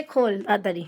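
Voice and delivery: usual speaking voice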